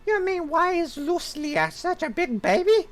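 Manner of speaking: very high-pitched girl voice, put on by a man; squeaky daughter voice. Daughter brushing off her father, very high squeaky voice. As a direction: squeak it out like a sulky young daughter. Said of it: "Doing female voices is not my forte".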